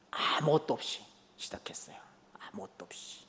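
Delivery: hushed whisper, nearly no voice. Real, not acted. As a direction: breathe the words out as a confession. Intimate recollection of humble beginnings.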